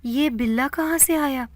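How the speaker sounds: high, shaken question; a frightened sister asks in a quivering voice; trembling voice. A narrator's reading. Sister sees the cat on her brother, trembling and scared. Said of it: "لرزتی ہوئی آواز میں کہا".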